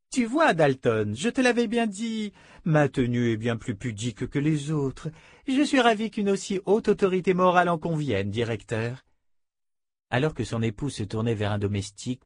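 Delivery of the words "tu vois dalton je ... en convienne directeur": a hint of an airy society lady, teasing; lightly voiced coquettish wife. A narrator's reading. Wife teasing her husband before the director, lightly voiced. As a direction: play her light and teasing, not overdone.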